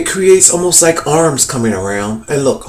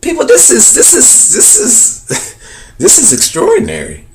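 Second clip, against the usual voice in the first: high, halting, laughing amazement; astonished; 9 semitones higher than usual. Real, not acted. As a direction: stammer in delighted disbelief, breaking into laughter. Overcome by his discovery, laughing.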